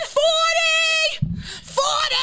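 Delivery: very high, screechy holler; road-rage yell. Acted. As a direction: shriek it at the slow car ahead. Acts yelling speed limit at drivers.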